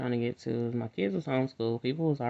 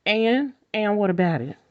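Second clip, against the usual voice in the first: loud, high challenge; indignant and what about it; defiant; 9 semitones higher, 7 dB louder than usual. Real, not acted. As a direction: throw it back at them. Defiant retort to critics.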